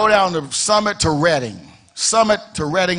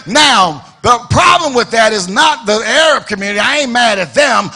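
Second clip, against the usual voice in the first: louder and louder pulpit crescendo; building preaching; 3 semitones higher, 4 dB louder than usual. Real, not acted. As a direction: build the sermon to a shouting peak.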